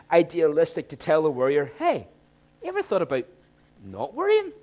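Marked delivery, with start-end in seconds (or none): 1.8-4.5 naive adviser. Pretend. Mock naive advice to worrier.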